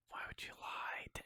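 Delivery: breathy whisper of disbelief; whispered outrage. Real, not acted. Reviewer accusing the filmmakers, whispered betrayal. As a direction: hush it like a betrayed confidant.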